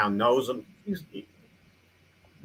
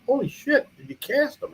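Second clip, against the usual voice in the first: retold amazed exclaim, much higher; stunned surprise. Reacting to George's footprint photos, much higher in surprise. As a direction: yelp it high like you can't believe it. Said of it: "I said".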